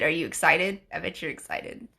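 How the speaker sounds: ordinary delivery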